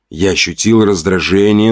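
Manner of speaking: plain reading voice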